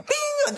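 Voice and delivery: imitated phone notification, sung high; high alert ping. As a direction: chime a sudden high bing.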